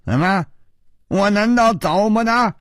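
high, cold challenge. A narrator's reading. Assassin turns round on his partner, cold challenge. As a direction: throw back a cold, rising retort. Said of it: "冷冷地说".

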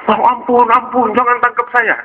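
high, wailing plea for mercy; pleading child thief. Acted. Caught boy begs Abu Hurairah not to seize him, pleading.

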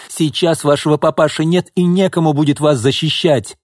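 high, heated tirade; angry threats. A narrator's reading. Pyotr threatens Alexei after being rejected, angry threats.

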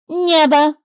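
the bird returning a word. A narrator's reading. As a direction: toss it back. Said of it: "вернула птица".

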